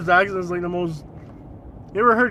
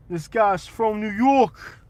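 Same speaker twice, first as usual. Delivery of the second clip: slow, drawn-out New York accent; hammed-up New York tough guy; slower than usual. Shown as a demonstration. Streamer returning to the requested bit, New York accent. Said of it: "Can you do a New York accent?".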